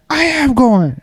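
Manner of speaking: high, insistent mock yell; retold yelling. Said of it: "expecting him to yell at me".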